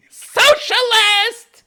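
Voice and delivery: hammed-up outraged heckler yelling the word; mock shout. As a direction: slowly bellow it like a jeering heckler.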